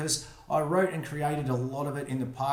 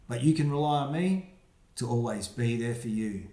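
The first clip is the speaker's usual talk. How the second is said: put-on reading to children. Reads rhyming picture book aloud.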